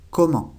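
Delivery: comment with a silent final T, nasal ending; French comment. Shown as a demonstration. Teacher models comment for students, final T silent. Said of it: "La última T no se pronuncia".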